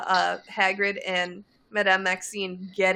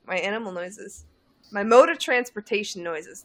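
ordinary talk

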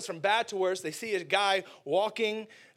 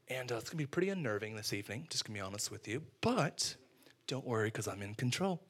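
soft, low, steady reassurance; calmly brief them on what's coming; soft calm leader voice; calm reassuring Jesus; 5 semitones lower, 7 dB quieter than usual. Acted.

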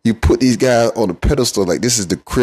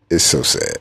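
lower, mock mournful sigh of a line; mock sad; 8 semitones lower than usual. Mocking Cowboys fans, much lower mock sadness. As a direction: pretend to grieve over them.

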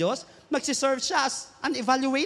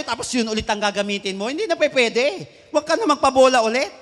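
ordinary delivery

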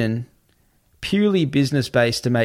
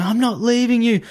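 high-pitched, mildly raised pleading cry; retold screaming; 9 semitones higher than usual. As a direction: act out her desperate scream without fully screaming. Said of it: "She starts screaming".

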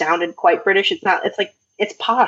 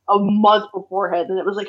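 ordinary talk, nothing marked

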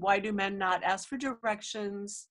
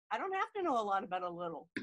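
quick, tumbling misstatement; rushed slip of the tongue; 5 dB quieter, much faster than usual. Real, not acted. Cohost fumbles her own joke, fast. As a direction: hurry the phrase out and garble it. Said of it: "did I say it wrong".

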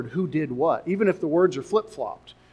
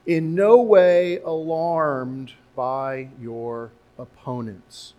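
slow reading; 3 semitones lower, 4 dB louder, much slower than usual. Acted. Reading scripture slowly.

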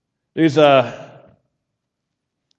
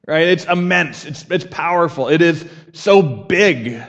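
ordinary delivery

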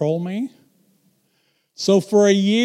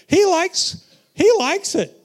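he likes it, pitched way up and pressed; excited emphasis; 12 semitones higher than usual. Real, not acted. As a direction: drive the point home with a sudden high push. Preaching, sudden high pitch.

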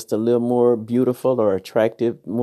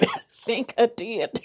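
laughing; 14 semitones higher than usual. Real, not acted. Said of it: "Did I say that right?".

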